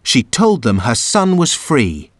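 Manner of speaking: usual speaking voice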